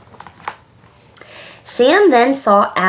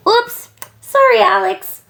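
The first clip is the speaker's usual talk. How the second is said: put-on high, hasty child's apology; breezy little oops in passing; said quickly; 14 semitones higher, 3 dB louder than usual. A narrator's reading. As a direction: toss off a quick high-pitched sorry. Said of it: "Hudson said quickly".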